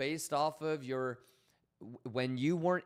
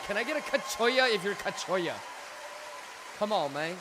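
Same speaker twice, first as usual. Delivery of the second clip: hype call; 6 semitones higher, 4 dB louder than usual. Real, not acted. Hyping up the chat.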